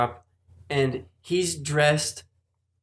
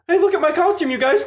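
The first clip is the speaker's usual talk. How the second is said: high-pitched, louder mock show-off; excited shop owner; 13 semitones higher, 5 dB louder than usual. Employee mimics the owner showing off his costume, high mock excitement. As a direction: imitate him gleefully showing off. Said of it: "he's going".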